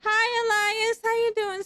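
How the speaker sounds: loud, high-pitched delighted hello; gushing high welcome; excited greeting. Real, not acted. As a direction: squeal hello to a friend who just arrived. Greeting a friend who raids.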